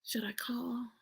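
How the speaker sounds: voiceless hesitant whisper. Retold or imitated. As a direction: ask yourself in secret doubt. Conflicted about the book deal.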